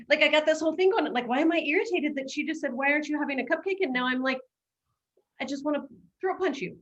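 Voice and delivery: high, fast, worked-up inner rant; hammed-up annoyance at a friend; mock irritation. Coach retelling her irritated inner voice, high and fast. Acting out irritation at cupcake offer.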